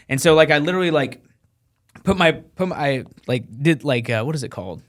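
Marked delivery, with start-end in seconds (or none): none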